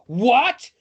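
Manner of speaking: loud, rising cry of disbelief; shocked exclamation. A narrator's reading. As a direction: burst out in astonishment. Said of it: "he exclaims".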